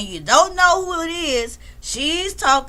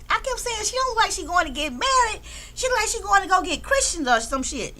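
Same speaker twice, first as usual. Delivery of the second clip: high amused voice; 7 semitones higher, 3 dB quieter than usual. Real, not acted. Amused aside, pitch jumps up.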